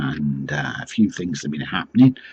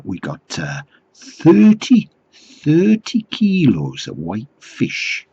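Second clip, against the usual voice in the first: slow put-on Geordie sales patter; mock Geordie accent; 3 semitones higher, 3 dB louder, slower than usual. Doorstep seller offers thirty kilos of fish, slow mock Geordie. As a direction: draw out the pitch in dodgy Geordie. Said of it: "I don't think it was a genuine accent".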